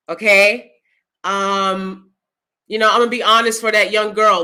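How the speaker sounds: ordinary talk, nothing marked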